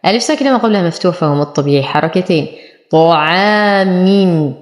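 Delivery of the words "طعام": طعام slow, with a full, heavy ط; heavy ṭāʾ. Shown as a demonstration. Teacher showing tafkhim, slow heavy ط in طعام. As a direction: draw out طعام with an emphatic first consonant. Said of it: "نفخم الطاء".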